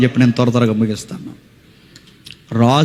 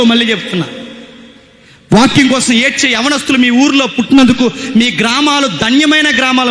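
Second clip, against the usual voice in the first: high-pitched, insistent sermon delivery; fervent preaching; 8 semitones higher than usual. Real, not acted. Preacher praising the town's young people, fervent preaching.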